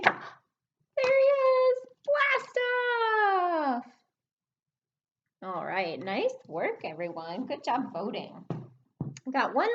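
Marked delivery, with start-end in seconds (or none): 0.0-3.9 excited shout. Pretend. Rocket found at last.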